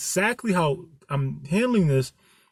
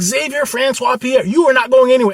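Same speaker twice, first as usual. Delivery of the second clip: high, louder mock scolding girlfriend; higher, louder hammed-up girlfriend forbidding him; 8 semitones higher, 7 dB louder than usual. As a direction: lay down the law, full name and all.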